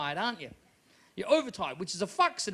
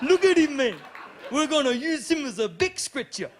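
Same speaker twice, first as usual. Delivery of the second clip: high Maori heckler calling out; Maori accent; 4 semitones higher, 3 dB louder than usual. Acted. Auckland crowd sizing up the comic, high Maori accent. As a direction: call to your mates in a high Maori voice. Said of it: "I did in Auckland on Anzac Day".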